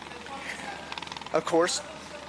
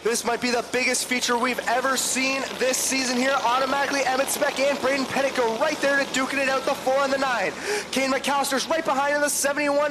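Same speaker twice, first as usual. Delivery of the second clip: higher, eager race call as cars go green; live play-by-play; 5 semitones higher than usual. Acted. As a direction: ride the action lap by lap.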